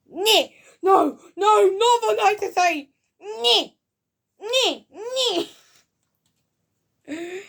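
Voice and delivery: very high, loud mock knight squeal; knights who say nyeh. Reviewer imitating the knights who say nyeh, high loud yelps. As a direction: yelp each word as a hammed-up silly knight.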